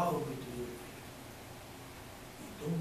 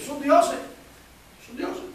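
mock awe; 11 semitones higher, 11 dB louder, much slower than usual. Mocking surgeons as gods.

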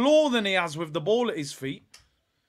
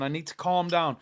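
normal talking voice

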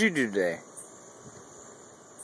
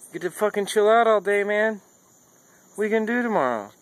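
high, raised pet-talk voice; 10 semitones higher, 4 dB louder, faster than usual. Pretend. Owner envying his dog's day off, high-pitched. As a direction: tease the dog in a high pitch. Talking to his dog about its life.

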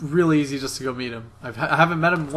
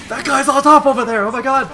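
high, loud, thrilled yelling; excited shout; 10 semitones higher, 7 dB louder than usual. Real, not acted. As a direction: shout it out in disbelief. Teammate spots enemy, excited yelling.

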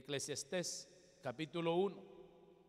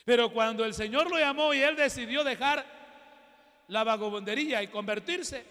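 full-force preaching; 5 semitones higher, 10 dB louder than usual. Acted. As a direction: hammer the point home to the congregation.

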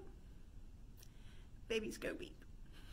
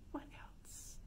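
ordinary talk, nothing marked

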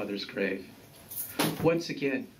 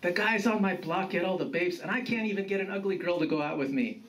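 higher, mildly frustrated grandson; pleading complaint; 7 semitones higher, faster than usual. A narrator's reading. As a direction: plead your case in a hurry. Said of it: "pleaded Juan".

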